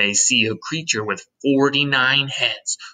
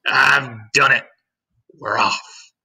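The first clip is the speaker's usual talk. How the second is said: triumphant shout; 5 dB louder, slower than usual. A narrator's reading. Centipede through the ceiling hole, triumphant shout. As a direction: announce success in a put-on shout. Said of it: "and shouted".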